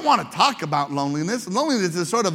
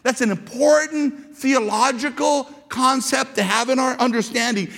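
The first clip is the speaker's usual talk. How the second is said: ordinary delivery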